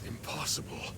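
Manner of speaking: stunned, barely voiced whisper; shocked whisper. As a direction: breathe it in disbelief. Shocked by revealed betrayal.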